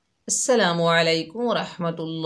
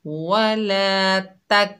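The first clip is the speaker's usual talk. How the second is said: very slow chanted वला तक, stopping on the क; Quran recitation; 2 semitones higher, much slower than usual. Shown as a demonstration. Teacher shows the jazm stop, slow chant. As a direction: recite it slowly and hold the stop. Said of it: "जैसे तक".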